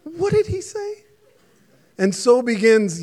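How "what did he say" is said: much higher, squeaky bewildered question; baffled. Retold or imitated. Roger and Glenn baffled by Dan's words, high and bewildered. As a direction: sound totally lost. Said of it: "Roger and Glenn are like".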